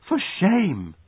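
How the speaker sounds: high-pitched reproach; scandalised cry. A narrator's reading. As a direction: cry out shocked disapproval. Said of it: "cried the maid".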